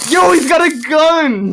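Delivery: high, slow mock alarm; retelling spotting the BB gun, mock alarmed yell. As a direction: call it out like sounding an alarm. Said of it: "I was like".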